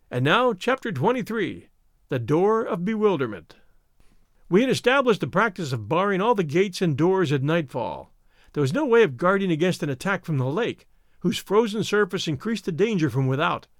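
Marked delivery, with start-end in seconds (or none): none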